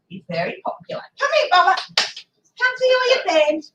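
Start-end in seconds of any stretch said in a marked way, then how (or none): 1.2-3.7 dog-coaxing voice. Real, not acted. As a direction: coax the dog in a sweet high voice.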